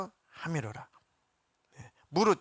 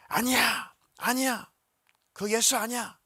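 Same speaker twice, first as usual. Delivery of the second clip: much higher shouted rejection; crowd shouting; 8 semitones higher than usual. Retold or imitated. Crowd yelling at Pilate to reject Jesus, high shout. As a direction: yell no at the top of your lungs. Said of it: "막 소리를 질러 말하기를".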